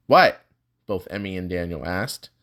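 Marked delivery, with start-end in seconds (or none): 0.1-0.4 loud, sharp, high what; a sudden loud questioning cry; startled what. Acted. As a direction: blurt it out in surprise. Both startled by Seth's remark.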